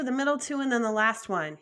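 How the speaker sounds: ordinary talk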